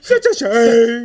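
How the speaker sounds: slow, high, loud fake sob; mock lament. Friend joining the mock grief, slow loud crying lament. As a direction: drag it out like a hammed-up mourner.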